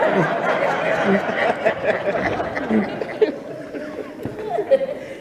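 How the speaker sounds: a long, trailing real laugh at his own joke. Real, not acted. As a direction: let the laugh roll out and fade away.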